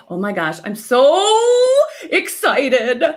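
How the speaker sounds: loud, bursting excited exclamation; loud excitement. Shown as a demonstration. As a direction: belt it out with excitement. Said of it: "See the volume".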